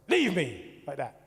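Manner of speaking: sharp loud shout of leave me; shouted command. Retold or imitated. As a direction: shout him away from the bed, loud. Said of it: "and goes".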